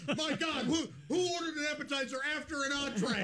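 high-pitched, hammed-up disbelief; mock alarm. Acts out shocked service technicians.

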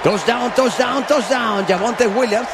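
high, drawn-out shouted touchdown call; excited touchdown call. Real, not acted. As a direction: stretch out every touchdown, soaring.

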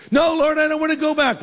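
put-on high-pitched childlike protest; hammed-up reluctant pleading; mock whiny refusal. Acts Paul refusing to return.

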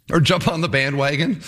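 normal talking voice

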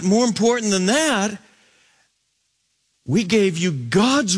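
high-pitched, urgent preaching; impassioned preaching. Acted. Preacher urging the congregation with the apostles' last words, impassioned preaching.

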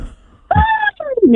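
high-pitched, drawn-out huff; a retold "fine" squealed in annoyance; exasperated giving in. Sleepy caller giving in to the voice, exasperated. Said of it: "I was mad 'cause I wanted to sleep".

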